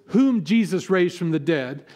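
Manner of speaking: normal talking voice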